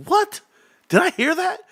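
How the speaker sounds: very high-pitched mock disbelief; shocked dad. Dad retelling overhearing his son curse, mock shock. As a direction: act out astonishment way up high.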